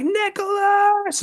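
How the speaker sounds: imitating a panicked mom screaming from downstairs; mom screaming a name. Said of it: "I hear my mom fucking scream".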